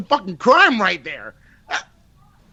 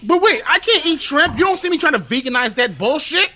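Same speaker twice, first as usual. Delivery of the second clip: high-pitched, worked-up protest; indignant tirade; 7 semitones higher than usual. Real, not acted. Host who can't eat shrimp, indignant tirade. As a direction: argue heatedly in a raised pitch. Renewed tirade about veganizing.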